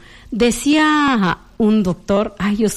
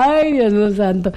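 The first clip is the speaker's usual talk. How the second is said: normal talking voice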